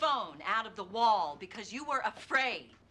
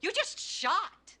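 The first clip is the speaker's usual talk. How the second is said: high, slow and pointed; heated accusation; 9 semitones higher, slower than usual. Acted. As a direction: drive the accusation home, word by word. Lawyer accuses witness of shooting.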